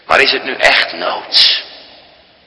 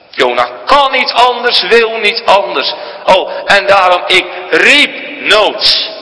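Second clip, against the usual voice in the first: high, ringing pulpit voice, slowed on the opening; sermon delivery at full force; full-force preaching; 8 semitones higher than usual. Acted. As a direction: proclaim it from the pulpit. Preaching climax.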